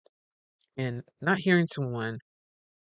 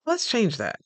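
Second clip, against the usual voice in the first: much higher, mock-condescending boss voice; imitating a fussy supervisor, pitched up; nitpicking boss; 8 semitones higher, 4 dB louder, slower than usual. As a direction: play the picky manager finding fault. Boss overriding.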